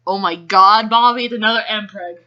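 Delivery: ordinary talk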